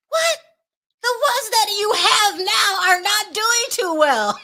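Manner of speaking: high, loud mock disbelief; scandalized, breaking into a laugh at the end; incredulous outburst. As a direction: exclaim it in exaggerated astonishment. Mock outrage at franchise news.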